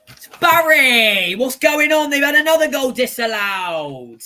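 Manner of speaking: excited outcry. Real, not acted. Shouting at the screen over a disallowed goal, excited and loud. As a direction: cry out at the screen as the goal is taken away.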